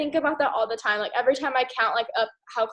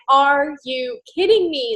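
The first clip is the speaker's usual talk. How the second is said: louder, slow, frustrated outburst; exasperated disbelief; 5 dB louder, slower than usual. Retold or imitated. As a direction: drag out the disbelief. Upset at volume dropping, retold.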